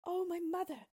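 acted sorrowful princess voice, far above narration; sad princess. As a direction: cry out sorrowfully as a young princess. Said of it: "replied Bellissima sadly".

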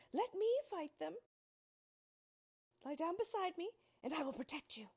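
a brave straw man stepping up; Scarecrow crying out. Acted. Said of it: "The Scarecrow cried".

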